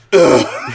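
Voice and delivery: loud disgusted ugh; imitated Patrice groan. Cohost imitates Patrice's noise, disgusted groan. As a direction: grunt of contempt. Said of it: "I've heard the".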